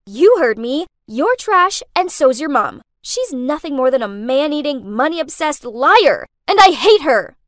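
loud, venomous rant; angry shouting, building to the last words; furious tirade. A narrator's reading. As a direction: spit out each insult at full volume. Screaming quarrel on the phone continues.

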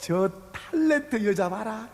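ordinary delivery

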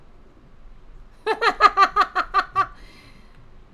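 high, loud peals of laughter; big laughing fit. Real, not acted. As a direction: burst out laughing helplessly. Cracking up at the texture glitch.

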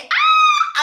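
very high, loud shriek; horror scream. Retold or imitated. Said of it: "we all scream".

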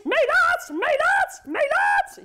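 high, loud repeated warning call. Retold or imitated. Sick men warn passers-by, calling out high and loud. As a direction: call it out so people keep away. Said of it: "die roepen steeds".

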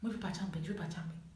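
small, soft mimicked voice; quiet, mock-wistful repetition; wishful would-be emigrant. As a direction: mimic someone murmuring their wish to leave. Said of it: "Chaque adulte veut partir en Europe".

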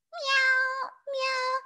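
put-on high playful meows for the kids; cat meow. Cat toy shown, "it's a cat.

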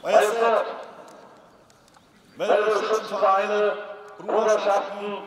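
lauter, langsamer Meldungston; barked drill commands. Real, not acted. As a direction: zackig und gedehnt melden. Formelle Meldung an den König. (militärische Meldung, laut und gedehnt)

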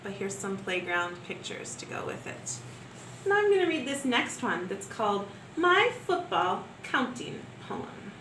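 ordinary talk

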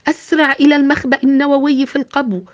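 high, loud panicked cry; frantic shout. Acted. Defence minister urging the president to flee, frantic shout. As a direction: yell at the president to run for cover. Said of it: "في انفعال جارف هتف".

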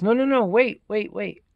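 high, hurried plea to stop; urgent interruption. Acted. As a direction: cut in to stop him at once. Wolf stops boy crushing egg.

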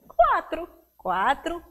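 normal talking voice

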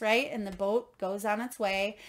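usual speaking voice

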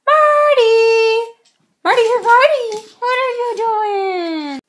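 high, loud singsong pet name; baby talk to pet. Acted. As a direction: call the kitten sweetly, up high.

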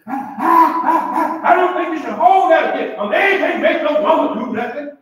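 high, loud stammering protest; mock upset member. Congregation member protesting the rule, loud, high and stammering. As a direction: play the flustered husband defending himself.

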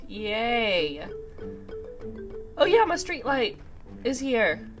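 ordinary delivery

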